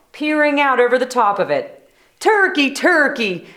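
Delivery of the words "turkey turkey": sweet, coaxing call, higher; sweetest voice. Acted. Moose coaxing Turkey from the nest, sweetly. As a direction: coax him out sweetly. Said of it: "in his sweetest voice".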